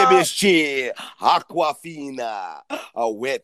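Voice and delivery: low, slow mock Dracula accent; hammy vampire accent, sinking in pitch; Count Dracula accent. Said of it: "he sounds like Count Dracula".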